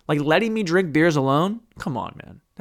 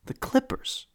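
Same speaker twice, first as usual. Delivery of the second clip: whisper; 9 semitones higher, 9 dB quieter, slower than usual. Real, not acted. Sports segment opener.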